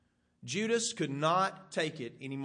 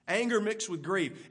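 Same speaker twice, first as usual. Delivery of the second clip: normal talking voice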